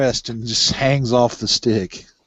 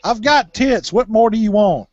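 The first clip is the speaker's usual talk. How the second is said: high mock voice; 8 semitones higher, 3 dB louder than usual.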